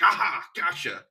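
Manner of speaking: put-on aha of catching someone out; mock gotcha. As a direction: imitate a triumphant gotcha, drawn out. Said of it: "point to that and be like".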